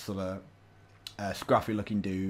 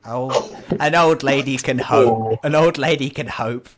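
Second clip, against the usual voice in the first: put-on loud, higher, drawn-out old-woman retort; mock-offended granny, projecting; indignant old lady; 4 semitones higher, 11 dB louder, slower than usual. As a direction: protest it heartily, playing for laughs.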